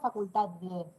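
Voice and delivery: facultad dicho como facultá, sin d final. Shown as a demonstration. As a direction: come la d de facultad. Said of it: "no decimos". (facultad sin d)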